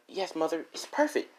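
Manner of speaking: high, obedient daughter voice. Acted. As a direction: chirp it up high for Mother. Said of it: "As Rose like".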